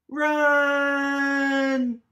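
loud, high cry to flee; urgent shout. A narrator's reading. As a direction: yell a warning to run. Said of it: "says Mouse".

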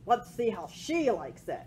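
usual speaking voice

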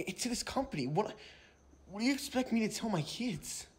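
usual speaking voice

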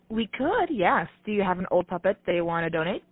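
ordinary delivery

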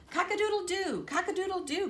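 high, loud cock-a-doodle crow; rooster crow. A narrator's reading. Rooster crowing to wake the barnyard, loud and high. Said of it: "crowed the rooster".

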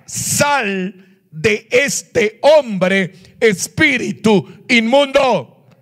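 very slow, word-by-word order; solemn, drawn-out rebuke rising in pitch; exorcism command. Acted. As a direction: cast the spirit out, each word separate and forceful. Acts Jesus casting out a spirit.

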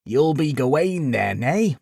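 put-on high old man, teasing with laughs; good-natured laughing old man. A narrator's reading. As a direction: rib him with a laugh. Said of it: "burst out in good-natured laughter".

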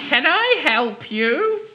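put-on high, slow, sweet old lady; old woman's voice. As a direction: ask gently like an old woman. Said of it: "she asked".

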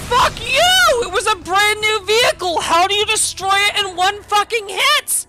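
raging at the screen, high and loud; furious shout. Real, not acted. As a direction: yell it in real fury. Rage at game.

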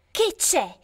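ordinary delivery, nothing marked

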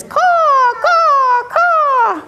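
high drawn-out crow calls; crow caw. Pretend. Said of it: "He says".

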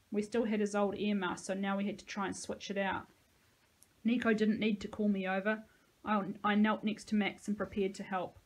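plain reading voice

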